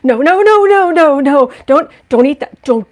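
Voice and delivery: loud frantic warning to the puppy. Retold or imitated. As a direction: stop the puppy eating nails, fast and urgent. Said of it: "I was just like".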